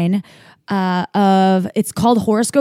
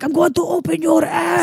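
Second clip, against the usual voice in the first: Israeli beach shout; 8 semitones higher than usual. Retold or imitated. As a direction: yell it like a beach player in Tel Aviv. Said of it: "people I would be playing would be like".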